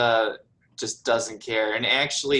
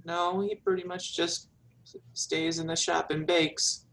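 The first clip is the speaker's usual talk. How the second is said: put-on higher-pitched girl's voice; meek daughter; 7 semitones higher than usual. Daughter tells visitor about her father, meekly. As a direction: explain modestly in a raised pitch.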